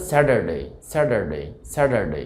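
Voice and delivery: American স্যাটারডে as "SAD-er-day"; American flapped t in স্যাটারডে; American pronunciation. Shown as a demonstration. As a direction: say স্যাটারডে with t turned to d and r sounded. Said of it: "American উচ্চারণটা হবে".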